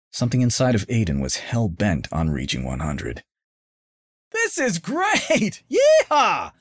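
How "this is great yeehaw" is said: very high, laughing whoop; thrilled scream. A narrator's reading. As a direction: scream with joy. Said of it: "screamed Oliver".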